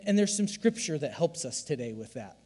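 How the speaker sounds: normal talking voice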